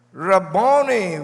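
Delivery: Rabboni called out loud and slow; cried out. Acted. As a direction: cry Rabboni in recognition. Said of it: "cried out in Aramaic".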